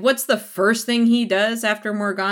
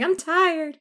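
whiny excuse; 11 semitones higher than usual. Retold or imitated. Tresselaine whining.

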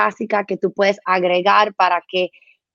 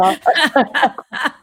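real laugh; 3 semitones higher than usual. Real, not acted. Laughs at joke.

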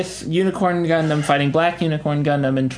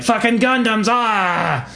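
high-pitched mock angry yell; angry outburst; 7 semitones higher, slower than usual. Retelling the angry pilot's reaction, mock furious. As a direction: rage as an enraged pilot, hammed up. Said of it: "he gets angry 'cause he's like".

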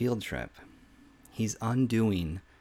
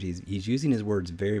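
usual speaking voice

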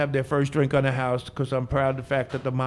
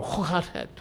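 put-on light Dutch accent, a little higher and softer; Dutch greeting; 4 semitones higher, 6 dB quieter than usual. Bartender greeting a visitor from Holland in Dutch. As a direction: greet them in throaty Dutch sounds. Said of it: "I can do that in, like, eighteen languages".

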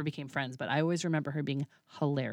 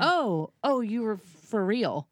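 1 surprised realization; 6 semitones higher, 6 dB louder, slower than usual. Retold or imitated. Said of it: "but he was like".

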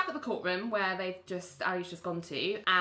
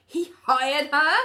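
surprised; 8 semitones higher, 8 dB louder, slower than usual. Retold or imitated. Georgia shocked at new hire.

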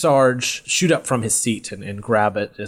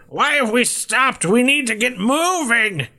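put-on very high, screechy old woman voice; yelling old woman; 12 semitones higher than usual. Old woman yells alongside Kako, high impatient voice. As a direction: complain in a very high, impatient cry. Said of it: "yelling alongside you".

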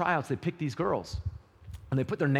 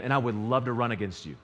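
ordinary talk, nothing marked

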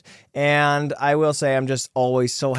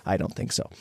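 whispered aside; 8 semitones lower, 6 dB quieter than usual. Real, not acted. Quiet aside denying being Irish.

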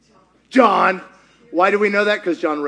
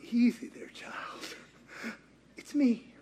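gentle Jesus; 13 dB quieter than usual. Acted. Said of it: "And Jesus goes".

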